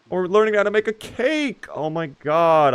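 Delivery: squeal-like excitement over cake; excited. Real, not acted. Delighted reaction to cake on show.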